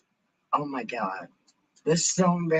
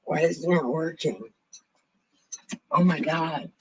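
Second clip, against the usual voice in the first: normal talking voice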